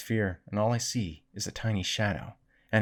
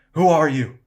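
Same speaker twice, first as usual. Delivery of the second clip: loud, high call thrown back; echoed call; 7 semitones higher, 11 dB louder than usual. A narrator's reading. Cavern echo answers Samantha, loudly calling back. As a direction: repeat the shout as an echo. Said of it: "the echo came".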